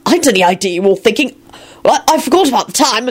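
high, flustered explaining; apologetic and frantic; frantic excuse. A narrator's reading. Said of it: "Lupin said frantically".

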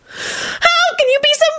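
very high slow wail; pitiful wail. A narrator's reading. Loretta to Quentin, very high pitiful wail. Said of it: "Loretta wailed pitifully".

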